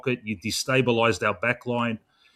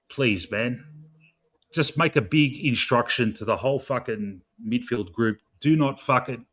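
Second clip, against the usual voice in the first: ordinary talk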